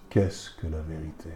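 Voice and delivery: lightly voiced Pilate, low and hushed; Pilate's question. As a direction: ask it low and hushed, like a sceptical governor. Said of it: "qui dit à Jésus".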